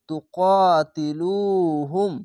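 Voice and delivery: তুকাতিলুহুম drawn out slowly, long vowels held; slow Quran recitation. Shown as a demonstration. Teacher to students, demonstrating slow careful recitation. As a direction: recite তুকাতিলুহুম syllable by syllable, stretched. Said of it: "এক আলিফ টেনে পড়বেন".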